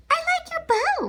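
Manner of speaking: ordinary delivery, nothing marked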